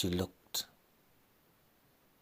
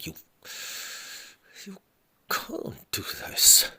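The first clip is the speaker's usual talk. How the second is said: very high breaking whisper, slowed; desperate plea; 14 semitones higher than usual. Acted. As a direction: beg him with the voice giving out. Wife begging husband not to leave.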